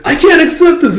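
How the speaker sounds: high, loud protest; mock outraged refusal. Daughter turning down dad's savings, mock outraged.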